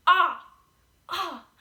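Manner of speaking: an open-mouthed "Ah" for the class to copy; demo ah sound. Shown as a demonstration. Teacher showing kids how wide the mouth opens on "Ah". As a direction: stretch "Ah" with the jaw dropped. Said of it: "Make the ah sound and see".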